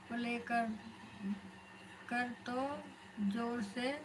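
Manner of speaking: normal talking voice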